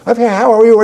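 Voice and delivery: imitated Chinese accent. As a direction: play the chirpy acupuncturist greeting his patient. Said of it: "He had this, this strong a-, accent".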